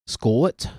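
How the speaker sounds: "Scott" drawn into two syllables in a Scottish accent. Shown as a demonstration. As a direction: give "Scott" a rising Scottish glide. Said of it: "two syllables of that".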